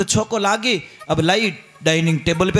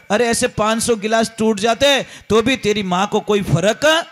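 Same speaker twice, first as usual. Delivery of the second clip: ordinary talk, nothing marked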